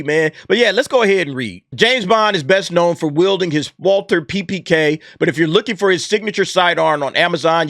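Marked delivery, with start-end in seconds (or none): none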